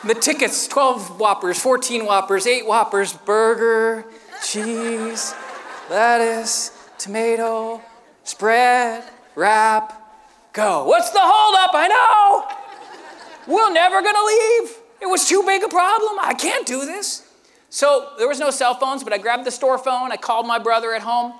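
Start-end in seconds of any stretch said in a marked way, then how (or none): 3.1-10.8 each word drawn out, long gaps between; laboriously slow. Acted. Novice cook assembling Whoppers word by word, laboriously slow. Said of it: "I can't make Whoppers that fast". 10.9-11.7 high, loud mock yell; retold yell. Supervisor yelling at the struggling cook, louder mock yell. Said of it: "Gabe's yelling back". 11.7-17.2 high-pitched, hammed-up wail; acted panic. Overwhelmed teenage cook facing busloads, hammed-up panic.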